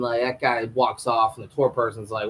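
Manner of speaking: ordinary talk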